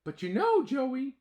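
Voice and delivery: high, loud ant kid playing wise; philosopher voice. Ant boy lecturing his friend, high mock-wise voice. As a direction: speak like a little philosopher. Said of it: "in his philosopher voice".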